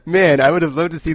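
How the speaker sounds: loud, high burst of enthusiasm; excited. Real, not acted. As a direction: gush over what you missed.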